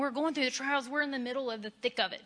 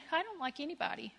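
ordinary talk

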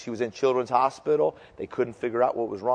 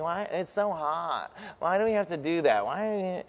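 whining; 3 semitones higher, 7 dB quieter than usual. Shown as a demonstration. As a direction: whine it like a child who hates the heat. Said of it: "Just always whining, like".

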